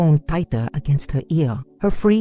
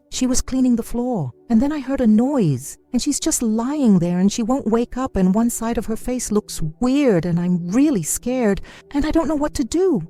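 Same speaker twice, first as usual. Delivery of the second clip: rushed sobbing; 8 semitones higher than usual. A narrator's reading. Girl explaining her mother's fall to a stranger, in a tearful rush. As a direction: get it all out between sobs. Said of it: "punctuated by hiccuping sobs".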